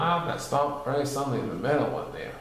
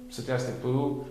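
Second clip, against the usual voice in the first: usual speaking voice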